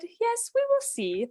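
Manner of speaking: high, doubtful wife voice; the never-satisfied wife replying, high and doubtful; skeptical wife. Retold or imitated. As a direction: pitch it up as if unconvinced.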